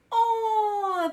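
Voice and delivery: high delighted aww; happy cry. Acted. Children cooing at a hamster, delighted aww. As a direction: coo like thrilled kids. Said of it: "they cried out happily".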